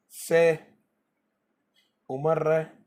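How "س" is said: س als scharfes stimmloses S; s sound. Shown as a demonstration. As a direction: zischendes S vorsagen. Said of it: "فمرة تقرأ".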